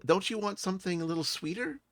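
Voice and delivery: high-pitched, questioning character voice; Zale. Acted. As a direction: pitch it high, as a coaxing suggestion. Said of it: "and Zale goes".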